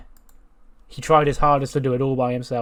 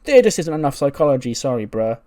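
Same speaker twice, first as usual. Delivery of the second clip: mocking critic; 3 semitones higher, 8 dB louder than usual. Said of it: "T W would go".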